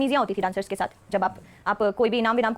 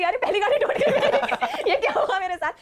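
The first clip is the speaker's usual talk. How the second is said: much higher, very slow, laughing; giggling at her own bad luck; laughing over her first car being smashed; laughing disbelief; 7 semitones higher, slower than usual.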